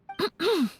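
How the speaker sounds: small, high, polite ahem; embarrassed throat-clearing. Acted. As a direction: clear the throat delicately to regain composure. Embarrassed at own outburst.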